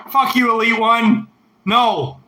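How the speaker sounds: loud teasing curse at a viewer; mock insult shout. Real, not acted. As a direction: yell at the chatter in jest.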